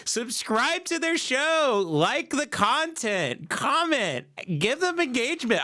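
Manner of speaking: high-pitched, sing-out fake endorsement; mock podcast promo. Pitching the worst couple's podcast, mock enthusiasm.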